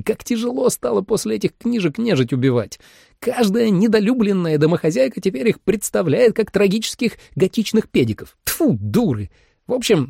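high, animated storyteller; excited high-voiced chatterbox; chatty hunter. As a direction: play a talkative hunter, pitched well up. Narrator voices chatty hunter Gus.

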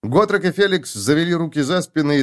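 ordinary delivery, nothing marked

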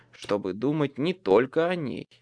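ordinary delivery

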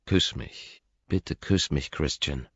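hushed, imitated plea; pleading. Man recalls her silent begging in his arms, pleading. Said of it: "mit diesem flehenden Blick".

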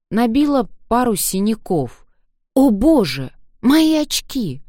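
high, rising dismay, slowing at the end. Acted. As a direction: gasp out the loss of the glasses. She loses her glasses.

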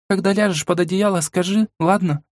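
put-on bashful young girl; shy girl voice. A narrator's reading. Blushing Mila. (смущённый девичий голос)

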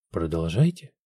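low, soft, coolly curious; feigned interest. Acted. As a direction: let her interest sound half put on. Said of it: "то ли подлинный, то ли притворный интерес".

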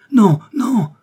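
high, loud shout of protest; crying out. A narrator's reading. Clown sees the scarf and protests, crying out. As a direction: cry out in sudden alarm. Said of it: "cria-t-il".

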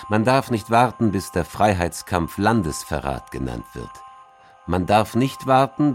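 ordinary talk

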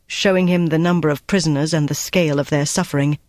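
ordinary delivery

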